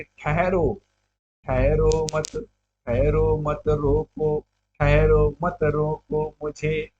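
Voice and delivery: slow, drawn-out chant-like reading; verse recitation. Acted. Teacher recites Kaikeyi asking not to be stopped, slow recitation. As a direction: recite with long, held vowels. Recites the poem's line, building it up.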